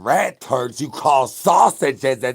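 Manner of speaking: sudden loud yell on the last word; yelled punchline; shouted. Acted. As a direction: blurt it out as a punchline. Ranting about pizza toppings.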